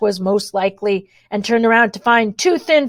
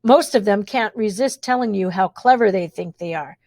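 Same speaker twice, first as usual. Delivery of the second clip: plain reading voice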